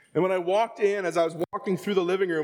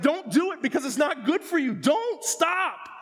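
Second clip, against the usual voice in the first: much higher, pleading warning; God warning; 9 semitones higher than usual. Retold or imitated. God warning his children, high and urgent. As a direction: warn like a loving father. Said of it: "when God is like".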